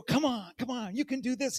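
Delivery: loud, high cheer of encouragement; cheering a runner on. Retold or imitated. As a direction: call out to push a tired runner forward. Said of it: "you go".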